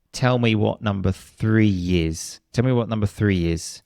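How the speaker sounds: three is said with a y sound linking three to is. Shown as a demonstration. Teacher showing learners the linking y sound. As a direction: slip a light y between three and is. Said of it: "You will hear a y sound there".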